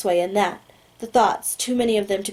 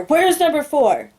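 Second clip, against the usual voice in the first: higher, louder and slow, a furious yell; screamed demand; 6 semitones higher, 5 dB louder, slower than usual. Acted. Agent yelling at the prisoner over the speaker, furious shout. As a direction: blast it through the speaker, losing all calm. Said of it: "He screams through the speaker".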